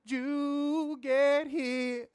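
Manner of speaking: high, very drawn-out grizzled croon; imitating CM Punk. Podcaster mocking wrestler's promo, high grizzled drawl. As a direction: stretch every word in a grizzled mock promo. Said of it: "talking in grizzled Gilbert Gottfried voice".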